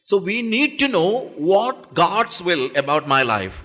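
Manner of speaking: very slow, words set apart; slow deliberate English. Acted. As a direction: lay it out slowly so everyone follows. Slow English restatement to congregation.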